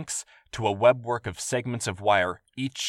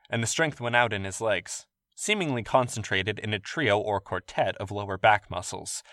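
plain reading voice

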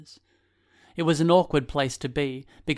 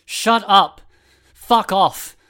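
high, loud furious yell; shouting; 7 semitones higher, 11 dB louder, much slower than usual. A narrator's reading. Fallen boy shouts at the screaming woman, loud. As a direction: scream at her to stop. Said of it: "the fallen boy shouted".

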